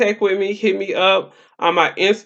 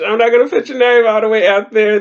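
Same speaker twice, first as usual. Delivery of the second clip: ordinary talk, nothing marked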